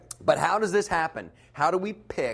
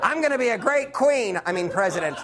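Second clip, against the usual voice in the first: Hillary voice; 6 semitones higher, 4 dB louder than usual. Pretend. Hillary quote continues, laughing.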